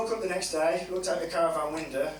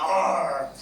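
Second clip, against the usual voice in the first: pirate cry; 2 semitones lower, 5 dB louder than usual. Retold or imitated. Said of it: "I'm getting into it at this point".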